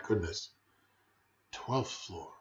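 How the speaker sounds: plain reading voice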